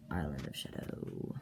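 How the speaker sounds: low, slow and hushed; ominous title. Acted. Storyteller announces part eight of the tale, low ominous hush. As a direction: sink down low and stretch out the title.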